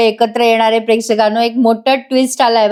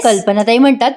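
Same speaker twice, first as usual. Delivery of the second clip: ordinary delivery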